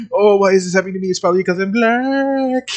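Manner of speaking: mock whiny Shadow. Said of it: "he'd be like".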